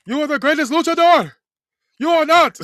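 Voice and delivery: yelling taunt. Acted. Gunther mocking Rey Mysterio.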